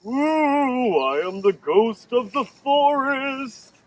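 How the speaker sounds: spooky ghost. Acted. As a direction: moan like a ghost to scare the scouts. Papa plays ghost.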